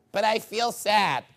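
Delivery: slow, mocking complainer's whine; mock whiny complainer. Preacher mocks a man who feels sad, slow mock whine. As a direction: drag it out like a self-pitying man.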